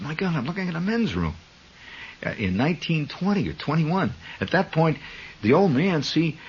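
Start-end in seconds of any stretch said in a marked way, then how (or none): 0.0-1.3 high, quick murmur of wonder; hushed amazement. Retold or imitated. Man to himself watching an old film, hushed amazement. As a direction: marvel quickly to oneself, pitched up.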